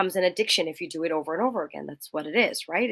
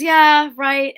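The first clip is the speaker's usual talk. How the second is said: sarcastic; 9 semitones higher, 11 dB louder than usual. Retold or imitated. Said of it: "she goes".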